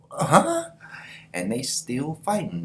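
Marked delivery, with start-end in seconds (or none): 0.1-0.7 very high, loud yelp of disbelief; startled huh. Acted. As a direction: jump at the sight. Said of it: "Miran's like".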